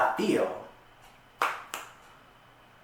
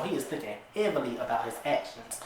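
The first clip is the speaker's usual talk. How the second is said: usual speaking voice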